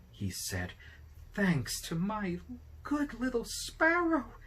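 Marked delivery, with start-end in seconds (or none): 1.3-4.4 put-on high, slow, delighted old man; much higher on the first sparrow, beaming with gratitude; overjoyed thanks. A narrator's reading. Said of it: "many times".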